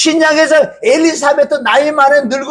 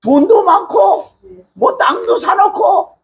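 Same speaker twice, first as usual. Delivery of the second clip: high, sneering boastful jeer; mocking rival voice; 7 semitones higher than usual. 교인 많은 목사가 개척교회 목사를 비웃는, 높고 깔보는 목소리. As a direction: play the proud rival looking down on the poor pastor. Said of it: "조금 전에 제가 얘기한 거".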